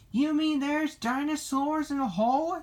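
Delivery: high-pitched, astonished question; exclaiming. A narrator's reading. Lassie hears of dinosaurs in a hole, high astonished exclamation. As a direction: ask in high-voiced disbelief. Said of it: "she exclaimed".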